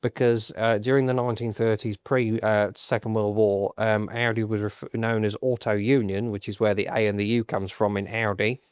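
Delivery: low, nasal know-it-all delivery; full nasal voice. Acted. Podcaster showing off Formula One knowledge, full nasal voice. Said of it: "go full nasal voice".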